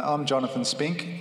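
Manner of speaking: normal talking voice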